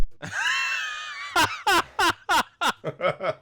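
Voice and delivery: high shrieking laugh. Acted. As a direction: burst into a squealing laugh. Faking a heart attack.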